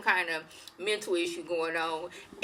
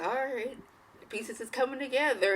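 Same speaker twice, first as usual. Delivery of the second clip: soft, higher, pleased scheming murmur; Lady Danvers watching the plan work, a soft gleeful aside; 4 semitones higher, 6 dB quieter than usual. Retold or imitated. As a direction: gloat quietly to yourself.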